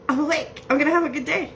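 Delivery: high-pitched mock client voice; pretending to be her. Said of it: "pretending to be you".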